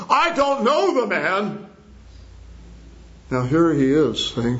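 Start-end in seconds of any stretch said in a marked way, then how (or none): 0.1-1.7 high, drawn-out acted denial; vehement denial. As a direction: swear it slowly in a raised pitch. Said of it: "even with oaths and swearings".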